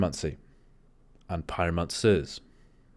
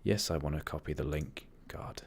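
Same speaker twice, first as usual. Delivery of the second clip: whispered aside; 3 semitones lower, 8 dB quieter than usual. Real, not acted. Aside while copying link.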